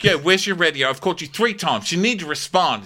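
a touch of a high nagging boss; mock scolding Storm. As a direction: scold him, lightly hammed. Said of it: "He's like".

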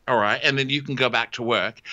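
usual speaking voice

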